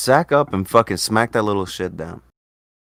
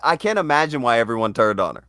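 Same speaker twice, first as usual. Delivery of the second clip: ordinary talk, nothing marked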